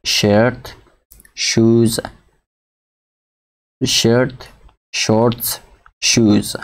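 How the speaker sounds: teacher modelling "sh" in شرت، شوز، شرت، شورتس، شوز, word by word; شرت، شوز، شرت، شورتس، شوز as separate words, each opening on "sh" as in "she"; SH sound demo. Shown as a demonstration. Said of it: "آواز شی نمیته".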